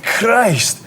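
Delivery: hammed-up Glaswegian, shouting; broad Scottish accent. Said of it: "heavy Scottish accent".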